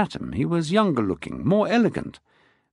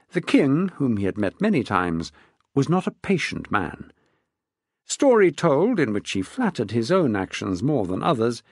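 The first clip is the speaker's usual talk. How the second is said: ordinary delivery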